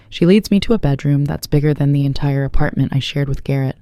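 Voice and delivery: plain reading voice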